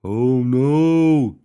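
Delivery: loud, high cry of dismay; big high-pitched dismay; alarmed gasp. A narrator's reading. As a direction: echo the alarm loudly. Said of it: "gasped Buddy".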